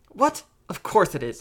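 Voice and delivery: quick indignant retort, high on 'What'; shooting back. A narrator's reading. Brother defends his word to little sister, retorting. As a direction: fire back, affronted. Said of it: "he shot back".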